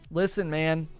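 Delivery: ordinary talk, nothing marked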